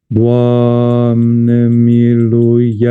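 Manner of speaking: Doamne miluiește very slow, the accented syllable held long; lengthened accent. Shown as a demonstration. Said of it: "C'est la durée".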